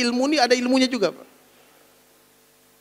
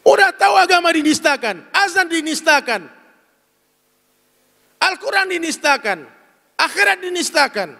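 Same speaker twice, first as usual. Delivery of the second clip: outraged preaching; rising outrage; 4 semitones higher than usual. Real, not acted. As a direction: denounce it to the crowd. Preacher denouncing blasphemy.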